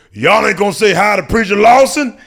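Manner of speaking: loud, high imitation of a hollering bouncer; big mock public call-out. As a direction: holler it to a group walking by. Bouncer calling out to arriving women.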